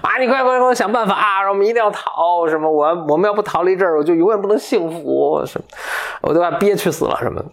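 put-on high, urgent begging girl's voice; pleading shepherdess girl. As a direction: beg him in a rush to run away. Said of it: "牧羊女说".

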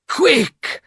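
sharp, high urgent call; urgent cry. A narrator's reading. Van Helsing urging friends to flee, high cry. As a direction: snap them into action, high. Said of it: "cried Van Helsing".

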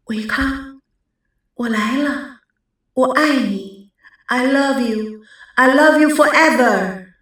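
faint, far-off spirit voice; a lightly voiced airy spirit calling; echoing ethereal voice. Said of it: "空灵的声音".

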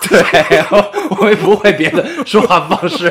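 laughing loudly while speaking; bursting into laughter mid-sentence. Real, not acted. Laughing at the label.

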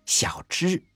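small, high-pitched hoarse reply; high and husky, just the name. A narrator's reading. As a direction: answer in a husky little girl's voice. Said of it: "用沙哑的声音回答说".

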